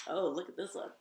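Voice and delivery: plain reading voice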